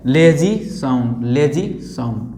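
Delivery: "Lazy" said slow, low and lazy; lazy sound demo. Shown as a demonstration. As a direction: drag "lazy sound" out lazily. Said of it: "तीसरा होता है". ("Lazy sound" धीमे आलसी ढंग से)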